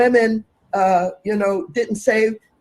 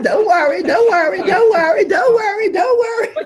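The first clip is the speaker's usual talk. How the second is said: high wailing don't worry, getting louder; mock crying; 11 semitones higher, 3 dB louder than usual. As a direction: ham up a sobbing plea, rising into a cry. Mocking crying plea in clip.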